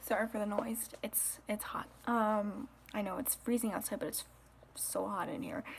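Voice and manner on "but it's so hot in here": whispering. Real, not acted. Quiet whisper, noise indoors.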